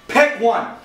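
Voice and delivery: normal talking voice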